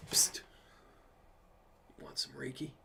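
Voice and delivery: mock drug dealer offering Reiki in a hush; shady dealer whisper. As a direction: lean in and sell it on the sly like a dealer. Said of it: "he was also a Reiki dealer".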